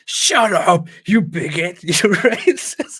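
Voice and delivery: high, loud jeering shout with the last word drawn out; mock yelling. Host mimics Cenk's cringeworthy outburst, mock yelling. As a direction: yell it in exaggerated outrage, laughing at it. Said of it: "so cringeworthy when Cenk yells".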